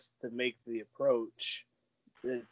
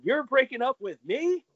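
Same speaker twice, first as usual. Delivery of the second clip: Seinfeld quote; 10 semitones higher, 6 dB louder than usual. Retold or imitated. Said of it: "like that Seinfeld episode".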